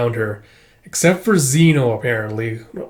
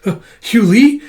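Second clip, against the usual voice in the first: high, loud rising question; acted astonishment; startled recognition; 10 semitones higher, 7 dB louder than usual. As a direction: call the name in surprise. Surprised at seeing a friend.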